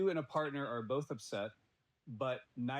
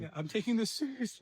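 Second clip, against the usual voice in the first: mock cutesy; 10 semitones higher, 5 dB louder than usual. Mocking his partner's cute tone.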